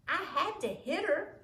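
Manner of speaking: high, loud defiant excuse; defiant girl. A narrator's reading. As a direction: justify yourself like a bold bully girl. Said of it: "Imogene told him".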